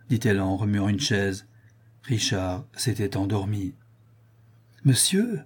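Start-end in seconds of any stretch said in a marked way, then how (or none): 4.8-5.4 high call to wake him; high sharp call of monsieur. Acted. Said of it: "s'écria la jeune femme".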